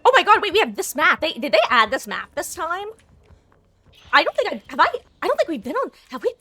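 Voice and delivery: loud, high, surprised burst; suddenly thrilled at the new map; excited. Real, not acted.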